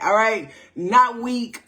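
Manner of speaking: ordinary talk, nothing marked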